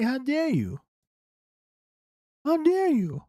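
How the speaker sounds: high, indignant mock outrage; hammed-up how dare you. Voicing outraged Southern Democrats.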